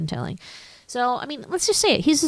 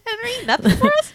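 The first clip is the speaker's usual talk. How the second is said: much higher, squeaky calling voice; high mock Auntie Em. Teasing Uncle Henry in a high Auntie Em voice. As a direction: call out to Henry in a high put-on voice.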